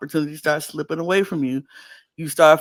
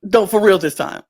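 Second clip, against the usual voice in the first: louder, pushing the point home; firm mock insistence; 3 semitones higher, 8 dB louder than usual. Host insisting to the queen, mock loud and firm. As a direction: play the host cutting her off, half joking. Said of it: "She's like".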